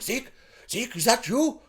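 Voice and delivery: soft, high, questioning call; mock tentative call. Father calling to his son in a teaser, mock hushed question. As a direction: call the name softly and high, unsure.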